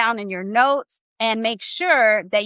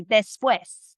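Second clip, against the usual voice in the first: slow demo word. Shown as a demonstration. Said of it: "Say it with me".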